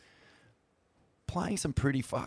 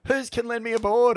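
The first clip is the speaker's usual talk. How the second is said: frantic plea; 13 semitones higher, 5 dB louder than usual. Retold or imitated. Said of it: "running around just going".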